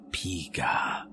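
low, drawn-out creature call; a hushed, low Pikachu-like Pika; Pikachu cry. Pretend. Father becoming Pikachu, low creature call.

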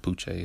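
"Poochie" said as poo-chay; French-sounding pronunciation. Shown as a demonstration. Host explaining his favourite nickname, French-sounding ending. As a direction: end "Poochie" on a French-style ay. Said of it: "It sounds French".

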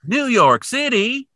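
high, slow sing-song; playful echo. Pretend. Echoing city name playfully.

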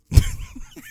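high, breathless laugh. Real, not acted. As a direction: burst of high wordless laughter. Laughs at his own argument.

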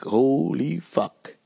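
stunned. Retold or imitated. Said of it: "And I was like".

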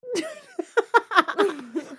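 loud high-pitched laugh. Real, not acted. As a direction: burst into laughter. Laughs at joke.

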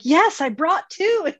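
a mock-smug answer; proud retort. Answering her friend's propane question, proudly. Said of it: "And I'm like".

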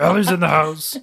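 high, loud hype announcement with a laugh; hype announcer. Pretend. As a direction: announce her like a club MC. Hype announcing her co-host.

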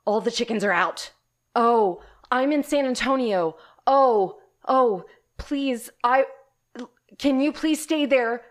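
loud, high, frantic pleading; panicked pleading. Retold or imitated. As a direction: beg down the phone in panic. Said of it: "I immediately went".

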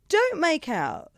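emphatic, exasperated comic retort; mock indignation. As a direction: scoff at a pretentious claim. Retold scoffing at customer's claim.

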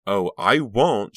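loud, slow, mocking sneer. A narrator's reading. Sarah glaring down at Delia, sneering. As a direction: draw it out with a sneer. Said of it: "she sneered".

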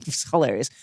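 ordinary talk, nothing marked

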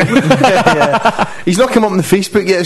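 laughing through the words. Real, not acted. Laughing at the joke.

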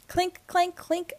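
high, light clinking sound; high-pitched sing-song clinks; imitated clinking. A narrator's reading. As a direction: chime the words like coins. Narrator imitates the heard noise.

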